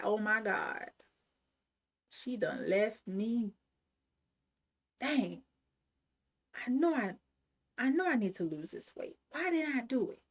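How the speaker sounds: a mild mock of a friend muttering to herself; envious friend.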